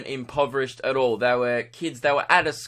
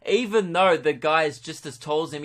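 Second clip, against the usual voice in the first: ordinary talk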